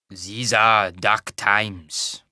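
put-on slow, heavy Tarbanese accent; thick Tarbanese accent. Wagon master warning a traveller, in a thick Tarbanese accent. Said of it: "in a thick Tarbanese accent".

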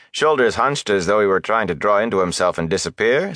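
usual speaking voice

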